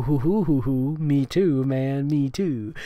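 ordinary talk

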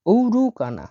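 ঔরুকানা with a long opening "ou"; model pronunciation. Shown as a demonstration. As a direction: stress the double-o start of ঔরুকানা. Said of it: "দুইটা ও মিলে ও উচ্চারণ হবে".

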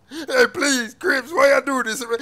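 loud crying beg to the gang; crying plea. Acted. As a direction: beg through tears. Captive pleading and crying.

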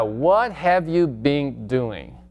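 very slow, word by word; slow first take; slow demo. Shown as a demonstration. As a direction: model it slowly for students. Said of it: "seguimos con la pregunta".

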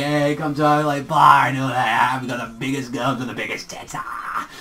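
lightly put-on sleazy club tout; scumbag. Reviewer mocking Deku's slicked-back look, lightly voiced scumbag. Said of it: "he looks like one of those people that would say".

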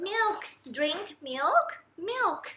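slow soft "milk drink milk", rising high at end; soft baby talk. Shown as a demonstration. Mother feeding her baby coos "milk", slow and soft. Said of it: "เราก็อาจจะต้องบอกว่า".

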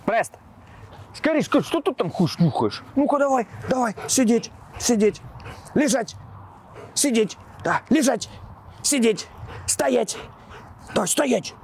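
high, eager commands to a dog; excited dog commands. Real, not acted. Owner commanding his dog.

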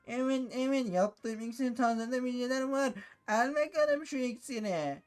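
witch voice. Acted. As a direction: drawl like an old witch luring him in. Potion seller offers potion. (yavaş ve ince cadı sesi)

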